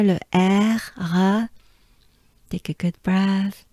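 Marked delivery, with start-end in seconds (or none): 0.0-1.5 "le R, Ra" with a French back-of-mouth R; tongue kept down for the R in "Ra". Shown as a demonstration. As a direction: demonstrate the French R in "le R, Ra". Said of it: "French R".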